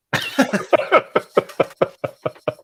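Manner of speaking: high, voiceless chuckle in quick bursts; long wheezy snicker, pulse after pulse; staccato laugh. Real, not acted. Reacts to own Red Skull quip.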